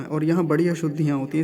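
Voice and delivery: usual speaking voice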